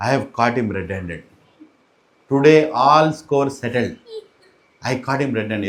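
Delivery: low, measured announcement of victory; smug accusation. Retold or imitated. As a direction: declare it low, savouring the catch. Gopi smugly announces her catch.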